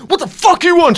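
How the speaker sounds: high, loud mock aggressive challenge; picking a fight. Hypothetical self getting out of the car to start a fight, aggressive. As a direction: square up and bark it. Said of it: "where I get too high on myself".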